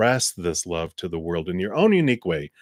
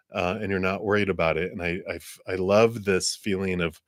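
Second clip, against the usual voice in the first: ordinary talk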